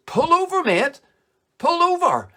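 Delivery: high, loud mock police shout; cop calling out. Australian cop pulls over a cyclist at night, calling out.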